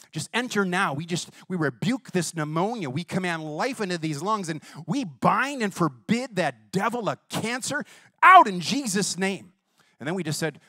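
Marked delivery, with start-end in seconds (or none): 0.1-9.5 forceful prayer. Retold or imitated. Preacher reenacts commanding prayer.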